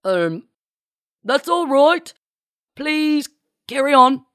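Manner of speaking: loud, high put-on British captain; British captain impersonation. A narrator's reading. Marcy poses as captain through door, hammed-up British. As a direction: bluff it in a hammed-up British accent. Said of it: "in her most British captain-esque voice".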